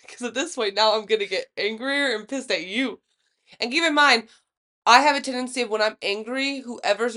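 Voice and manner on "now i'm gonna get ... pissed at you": normal talking voice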